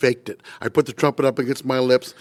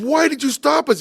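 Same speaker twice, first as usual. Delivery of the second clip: much higher, sharp demand; indignant question; 14 semitones higher, 3 dB louder than usual. Retold or imitated. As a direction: demand it, baffled and cross. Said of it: "he said".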